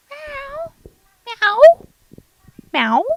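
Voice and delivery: playful cat meows. Acted. Goofing around, meowing like a cat. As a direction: mew like a cat.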